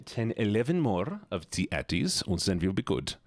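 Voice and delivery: animated mock German accent, conceding; put-on German accent. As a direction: reason it out with a comic German accent. Keeps up German voice.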